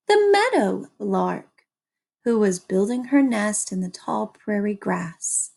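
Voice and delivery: ordinary delivery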